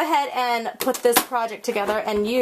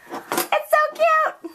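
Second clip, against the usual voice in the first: much higher, slow drawn-out squeal; squeaky high voice; much slower than usual. Real, not acted. Crafter squealing at her finished card, slow and high. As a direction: stretch out cute in a squeal. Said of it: "high-pitched squeaky voice".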